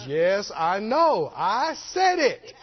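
drawn-out, weighty concession; slow emphatic reply. Retold or imitated. Holy Ghost answering a quoted verse, slow and emphatic. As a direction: stretch each word for emphasis.

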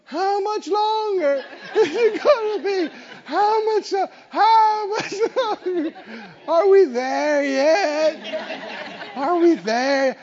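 put-on high whiny child voice; high, drawn-out whining child; whining kids. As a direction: whine like a bored kid in the back seat. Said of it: "little ones in the back have decided to whine".

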